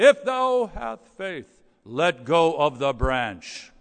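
slow, loud solemn boom; the man repeats God's words back, slow and grand; mock booming God voice. Hanging man echoing God's command in a booming voice. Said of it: "He says, "But wait a minute".

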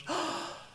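high, surprised little gasp; a sudden delighted intake of breath. Retold or imitated. Old lady gasps with delight seeing the therapist again. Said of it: "and she goes".

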